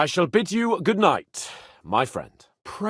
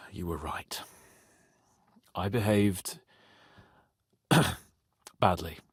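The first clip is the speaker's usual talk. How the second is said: soft unvoiced apology; whispered admission; 8 semitones lower, 6 dB quieter than usual. Real, not acted. Apologizing to Henry in a sheepish whisper. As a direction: breathe it out, ashamed.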